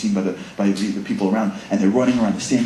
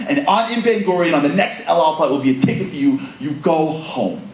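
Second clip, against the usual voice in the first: stern ultimatum; 5 semitones higher, 4 dB louder than usual. Retold or imitated. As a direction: lay down the final ultimatum.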